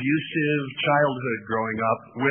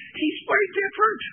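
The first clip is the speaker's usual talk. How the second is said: voice breaking. Real, not acted. Pastor's cold voice cracking mid-sermon, voice breaking. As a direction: voice cracks up high mid-phrase. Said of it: "my voice is still breaking".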